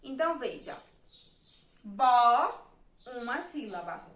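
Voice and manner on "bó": loud, separated syllable Bó; teacher counting syllables on her fingers; syllable clapped out. Shown as a demonstration. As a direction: punch out Bó as its own beat. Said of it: "Eu vou marcar a sílaba".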